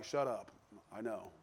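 low, hushed brush-off; curt retort. Retold or imitated. Prophet silencing people nagging about his master, curt. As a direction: quietly shut them down, pitched low. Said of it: "He said it much nicer than that".

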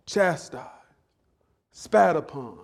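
ordinary talk

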